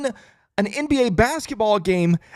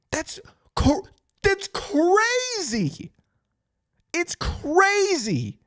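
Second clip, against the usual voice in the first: high and very slow; awed disbelief; 9 semitones higher, much slower than usual. Real, not acted. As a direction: stretch it out in shock. Disbelief at the comeback.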